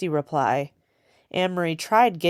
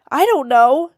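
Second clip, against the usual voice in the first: much higher and louder, starting to cry; choked up; 10 semitones higher, 9 dB louder than usual. A narrator's reading. Girl crying to the soldier searching her basket, choked. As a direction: let the tears break through. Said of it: "her voice choked".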